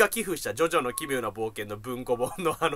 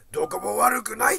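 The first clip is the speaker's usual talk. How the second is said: put-on louder and higher, the old man blurting it out; 6 semitones higher, 5 dB louder than usual. The old patient loudly telling the whole waiting room he's fine. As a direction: belt it like a deaf old man who doesn't care who hears. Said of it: "めっちゃでかい声でさ".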